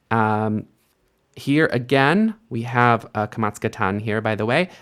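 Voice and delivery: usual speaking voice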